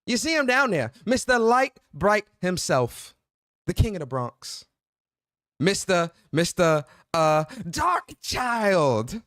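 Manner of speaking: bigging up your bro like a ring announcer; hyped arena-style introduction of his co-host; hype-man introduction. Acted.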